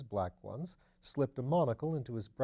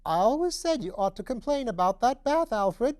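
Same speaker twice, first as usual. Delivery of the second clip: put-on much higher, louder piping old lady; high piping deaf voice; 12 semitones higher, 10 dB louder than usual. A narrator's reading. Deaf old mother scolding her son, high and piping. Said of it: "in the high, piping voice peculiar to the deaf".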